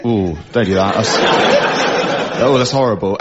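cat voice. Retold or imitated. The cat complains at being stroked, laughing groan. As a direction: moan like a stroked-backwards cat.

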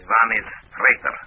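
flat Russian denouncing a traitor; Russian accent. Acted. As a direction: accuse coldly like a Soviet agent. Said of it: "in my best Russian accent".

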